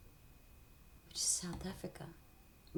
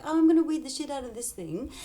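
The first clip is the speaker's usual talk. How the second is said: higher, louder, quick and fired up; mock determination; 8 semitones higher, 7 dB louder, faster than usual. Gardener vowing to clear weeds, loud and eager. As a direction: charge at the weeds, pumped. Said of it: "when you're like".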